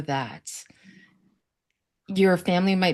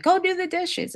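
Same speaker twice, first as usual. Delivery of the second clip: mom's order, pitched much higher; imitating mom yelling; 12 semitones higher than usual. Mom scolding bookish daughter, high mock yell. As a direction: mimic a stressed mom barking an order. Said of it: "yell at me".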